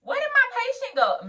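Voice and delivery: high, loud retold panic; hammed-up frantic question; freaking out. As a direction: cry it out in mock alarm, pitch way up. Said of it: "Me, I am freaking out".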